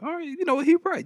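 high-pitched put-on congregant voice; won-over congregant. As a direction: agree in a high, acted voice. Voices congregation agreeing with the preacher.

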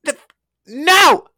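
high, loud mock-outraged shout; exasperated high holler; exasperated yell. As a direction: yell it in comic disbelief. Mock outburst at the video people.

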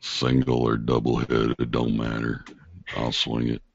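low, slow hillbilly drawl; hillbilly accent, low and menacingly unhurried; scary hillbilly accent. Acted. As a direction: drop into a creepy, unhurried backwoods accent. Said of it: "saying that in that accent is really scary".

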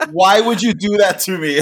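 retold complaint through laughter; giggling mock betrayal; laughing mock complaint. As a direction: imitate the friend's whine while laughing. Friend's reaction retold.

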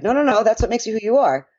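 imitating a friendly objection; retold earnest protest. Retells protesting to people losing accents.

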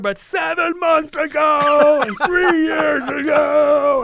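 high, loud mock rant listing old grudges; mock angry wife. Husband imitating his wife raking up old fights, loud and high. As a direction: shout it like she's dragging up years of grievances.

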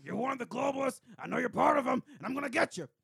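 high, loud ranting conspiracy host; Alex Jones. Pretend. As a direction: rant and yell like a radio conspiracy host. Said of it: "It's all right, Alex".

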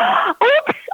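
put-on high surprised gasp of a child; child's gasp. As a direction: gasp like a caught kid. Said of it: "It's like".

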